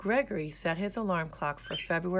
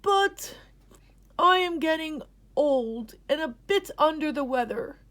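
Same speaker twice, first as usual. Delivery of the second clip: grandpa's wise explanation; old grandpa explaining; 11 semitones higher, 8 dB louder than usual. A narrator's reading. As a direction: lay out the rules like a wise elder. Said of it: "said Grampy".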